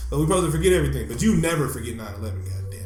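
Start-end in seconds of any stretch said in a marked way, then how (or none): none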